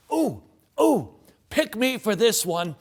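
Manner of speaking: put-on high, loud eager child pleading; eager kid. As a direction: wave your hand, desperate to be picked.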